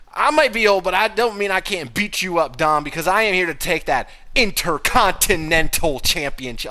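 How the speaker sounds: high, loud wrestler promo delivery; hammed-up promo. Retelling AJ's promo to the co-host, loud and high. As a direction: announce it like a ring challenge.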